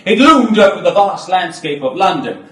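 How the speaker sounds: loud, sweeping narration; looming declaration. Acted. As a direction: make the building loom. Dramatic stage narration.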